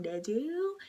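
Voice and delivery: half-whispered taunting question; sly reveal. Retold or imitated. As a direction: imitate her springing the trap, nearly whispering. Retold girlfriend revealing the trap.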